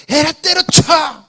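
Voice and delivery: high-pitched, drawn-out mock angry shout; acted fit of temper, pitch jumping up; angry outburst. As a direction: act out losing your temper on the spot. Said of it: "우리는 화나면 바로 당장에".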